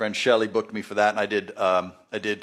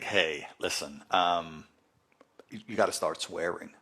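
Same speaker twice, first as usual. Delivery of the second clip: hushed aside; 7 semitones lower, 9 dB quieter than usual. Retold or imitated. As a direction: lean in and pass it on quietly, like a secret tip. Boss quietly tells him to swear.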